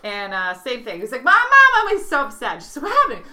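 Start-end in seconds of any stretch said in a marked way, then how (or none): 1.2-2.0 put-on high, loud upset child calling; kid yelling mom. Second boy upset. 2.8-3.2 high, loud startled question; alarmed mom. Retold or imitated.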